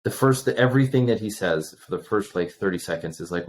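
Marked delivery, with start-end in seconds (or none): none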